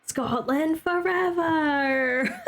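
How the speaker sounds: high, long-drawn patriotic cheer; hammed-up cry of national pride; rallying cry. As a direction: draw it out like a mock battle cry. Put-on cheer for Scotland.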